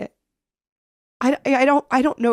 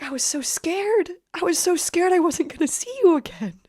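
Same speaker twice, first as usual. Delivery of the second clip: tearful relief; 8 semitones higher than usual. Retold or imitated. Mom tearful, scared.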